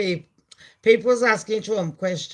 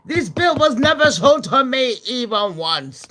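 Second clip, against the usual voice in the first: mock indignant Musk; 5 semitones higher, 6 dB louder than usual. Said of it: "That's what Elon said".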